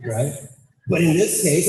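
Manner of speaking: usual speaking voice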